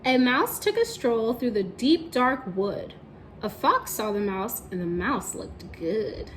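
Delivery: slow, expressive read-aloud; children's story narration; storybook narration. A narrator's reading. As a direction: tell it to the class like a story.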